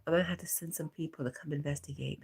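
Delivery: ordinary talk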